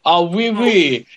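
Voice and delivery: put-on French accent breaking into a laugh; mock French accent. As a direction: Eurovision host in a fake French accent. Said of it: "You sound like you're on the Eurovision".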